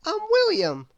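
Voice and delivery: put-on bright childlike schoolboy voice; young William. A narrator's reading. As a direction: pitch it up like an eager small boy. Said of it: "replied William".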